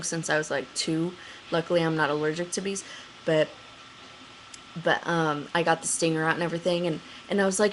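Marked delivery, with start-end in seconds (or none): none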